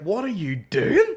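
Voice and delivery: loud, high, drawn-out incredulous question; hammed-up baffled outburst; mock bafflement. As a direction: ask it in comic exasperated disbelief. Mock baffled reply to farmer.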